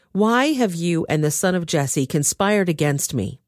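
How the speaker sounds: usual speaking voice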